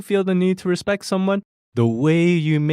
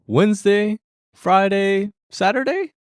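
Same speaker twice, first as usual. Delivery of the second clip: Wednesday, Friday, Saturday each pitched high as a question; rising question intonation; 5 semitones higher, slower than usual. Shown as a demonstration. Teacher translating guesses at the day, Wednesday, Friday, Saturday in rising intonation. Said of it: "Rising intonation, rising intonation".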